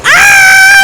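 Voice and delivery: long, high, loud scream; alarmed scream. Real, not acted. Gamer being eaten in the game, loud high scream. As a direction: scream as if grabbed.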